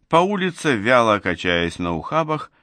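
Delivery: ordinary delivery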